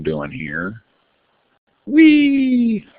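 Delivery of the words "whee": high, drawn-out playful "whee"; high-pitched mock-excited whee. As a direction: squeal it like riding a slide. Playful noise while moving things.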